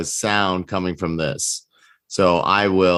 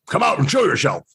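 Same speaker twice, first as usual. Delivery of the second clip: higher, commanding hobgoblin order, a touch hammed-up; hobgoblin imitation again; 7 semitones higher, faster than usual. Player's hobgoblin commander calls through the door, mock command. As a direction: call them out like a war commander. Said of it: "in my best Hobgoblin imitation".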